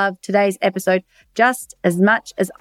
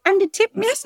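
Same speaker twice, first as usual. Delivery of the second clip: much higher, sing-song mock barista voice; 11 semitones higher than usual. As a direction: lampoon the chirpy tip request, pitched up high. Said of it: "they're like".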